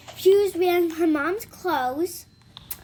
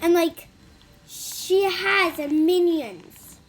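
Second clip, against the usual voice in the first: normal talking voice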